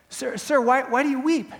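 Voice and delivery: high, loud, stammered cry; anguished question. Acted. Defendant asking a weeping stranger, anguished high-pitched question. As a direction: call out to him, pleading to understand.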